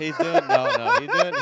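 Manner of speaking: squeaky high laughing protest; giggling squeal of disbelief. Real, not acted.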